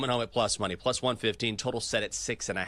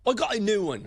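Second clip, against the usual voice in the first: mock British yell; 8 semitones higher than usual. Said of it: "this guy just yelling into his phone".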